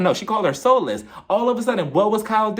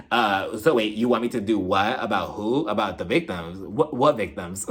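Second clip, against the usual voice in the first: imitated low, halting confusion; Erica impression; 9 semitones lower than usual. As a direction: play dumb in a low voice. Voices Erica's cool feigned confusion.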